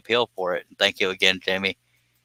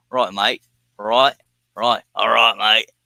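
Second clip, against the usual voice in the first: put-on jokey Australian accent with rising lilt; slower than usual. Streamer testing his mixed-up accents, put-on Australian. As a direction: try out a matey mock Australian. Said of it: "That might have been Australian".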